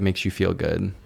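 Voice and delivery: ordinary delivery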